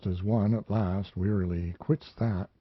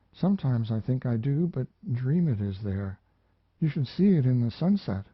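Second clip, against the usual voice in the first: put-on higher, wistful lonely girl; a lonely young woman remembering, a little lifted; higher, wistful voice of a lonely mountain girl; young woman's voice; 5 semitones higher than usual. Mariana speaks to the narrator.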